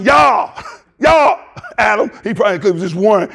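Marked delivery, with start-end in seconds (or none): 0.0-2.1 hollering for Adam across the garden; God calling Adam. Retold or imitated. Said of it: "He walked in the garden".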